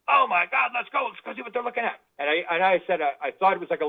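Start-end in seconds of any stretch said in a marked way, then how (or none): 0.1-2.0 higher, eager retold exclamation; imitating his own thrilled outburst; excited. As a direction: rush off like you just saw proof. Retelling his reaction to film.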